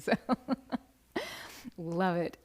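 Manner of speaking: ordinary delivery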